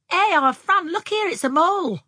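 high, loud call to a sister; excited calling out. Acted. Anne calling her sister over to see a mole, excited.

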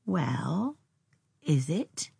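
drawn, questioning challenge; cold. A narrator's reading. Young woman looking steadily at Tom, coldly. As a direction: put it back to him coolly.

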